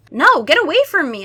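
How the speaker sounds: high, loud panicked yell; howl. Acted. [name] pushing the vampire away, loud howl. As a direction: shout them off. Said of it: "I howled".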